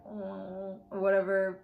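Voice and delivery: lightly mock their offhand shrug; imitating that person. Reader lightly mimics how the querent talks, mild mock. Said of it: "that person kinda, like, talks like that".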